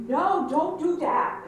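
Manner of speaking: slow, firm "No, don't do that"; slow firm warning. Retold or imitated. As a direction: drag it out like a mild scold.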